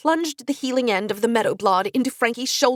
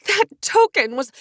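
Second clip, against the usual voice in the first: urgent protest; 10 semitones higher, 6 dB louder than usual. A narrator's reading. Interrupted protest.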